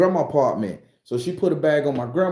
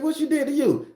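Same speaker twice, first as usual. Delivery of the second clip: high disbelief; 12 semitones higher than usual. Retold or imitated. Grandson repeating his question to the girl, very high. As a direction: ask again, voice pitched way up.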